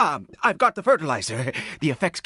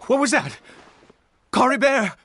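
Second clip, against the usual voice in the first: high, louder startled cry; alarmed call; 10 semitones higher, 4 dB louder, faster than usual. Real, not acted. Father realizing his son is missing, alarmed cry. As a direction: call out his son's name in sudden alarm. Notices son gone, calls out.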